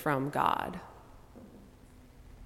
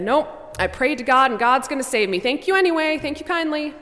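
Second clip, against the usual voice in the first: cheerful stubborn refusal; 7 semitones higher, 4 dB louder than usual. Acted. Pete refusing boat.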